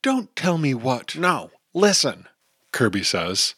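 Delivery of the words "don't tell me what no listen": higher, urgent hush between friends; tense kid voices. Acted. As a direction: cut them off, a touch higher. Dagny and Kirby argue.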